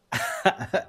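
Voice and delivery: sudden high laugh that bursts out; real burst of laughter. Real, not acted. Host laughing at the angry caller, high burst of laughter. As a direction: let a high laugh escape. Amused at Todd's rant.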